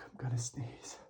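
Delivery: urgent whispered warning; whispering. Real, not acted. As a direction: whisper before sneezing.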